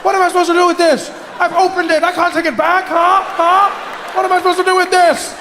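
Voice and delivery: whine-shout as the frustrated man; mock outburst. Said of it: "And I say".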